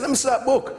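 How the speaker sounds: half laughing, reaching for the book. Retold or imitated. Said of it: "and I said".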